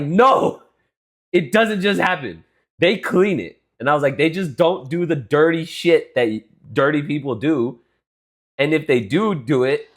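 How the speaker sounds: high, loud lecturing rant; exasperated. Retold or imitated. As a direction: insist loudly, fed up. Retold reply, still heated.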